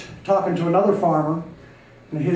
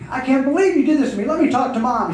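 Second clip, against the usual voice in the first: higher, hurried indignant complaint; upset reproach; 6 semitones higher, 3 dB louder than usual. Acted. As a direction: reproach a friend who let you down. Upset owner reproaches his friend.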